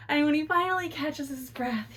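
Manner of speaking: ordinary delivery, nothing marked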